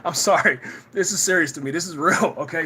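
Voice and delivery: ordinary talk, nothing marked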